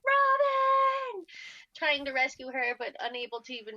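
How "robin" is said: calling out. Retold or imitated. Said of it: "I was like".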